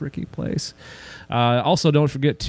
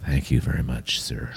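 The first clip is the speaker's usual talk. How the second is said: very low, soft creepy thanks; mock creepy voice; 11 semitones lower, 6 dB quieter than usual. Thanking the co-host for praise, mock creepy low voice. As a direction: drop it way down and creep them out. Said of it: "be creepy at each other".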